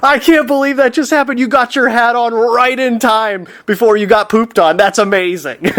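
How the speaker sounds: high, fast, amazed exclaiming; excited exclaim. Retold or imitated. Woman who saw the bird poop, excited high exclaim. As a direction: gush in disbelief at what you just saw. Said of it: "exclaims out".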